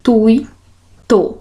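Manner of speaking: gra, tui, to as three separated syllables; measured syllable count, tui kept as one beat. Shown as a demonstration. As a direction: break it into gra... tui... to, pausing between each. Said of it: "vão ter aqui três sílabas".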